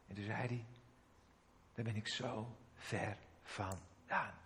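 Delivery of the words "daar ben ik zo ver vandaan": much softer and slow, near tears; crying harder. Retold or imitated. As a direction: let the sorrow slow every word. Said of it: "begon hij nog harder te huilen".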